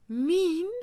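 high, shocked gasp of disbelief. A narrator's reading. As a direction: gasp the word, stung. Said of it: "gasped Maguire to himself".

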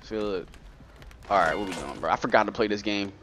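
usual speaking voice

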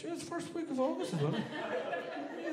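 very high, squeaky voice; cheeky Irish suggestion. Acted. Irish person claiming an August holiday, high cheeky squeak. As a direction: slip it in high and light.

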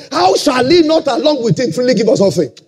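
ordinary delivery

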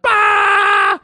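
loud, high mock guffaw; explosive, high-pitched mock cackle; imitated burst of laughter. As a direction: blurt out an exaggerated laugh, imitating a friend cracking up. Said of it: "Canner just bursts out laughing".

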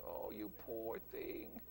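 low, soft, slow cooing; mock pity. Mocking sympathy for people who want stroking, slow soft coo.

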